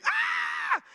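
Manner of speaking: very high-pitched shriek; mock a wife's panicked scream; piercing high scream; imitated scream. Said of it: "her voice just screaming".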